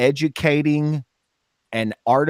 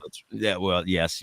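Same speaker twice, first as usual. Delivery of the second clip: ordinary talk, nothing marked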